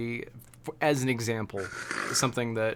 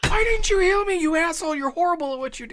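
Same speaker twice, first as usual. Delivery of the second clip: mock rage, much higher and louder; furious yell; 5 dB louder than usual. Imitating a dead DPS player screaming at the healer, hammed-up rage.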